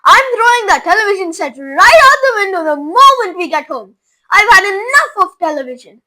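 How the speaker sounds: angry father. A narrator's reading.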